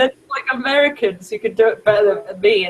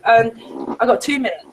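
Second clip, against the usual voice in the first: ordinary talk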